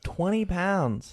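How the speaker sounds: slow, high disbelieving echo; incredulous. Real, not acted. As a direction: drag it out in disbelief. Incredulous repeat.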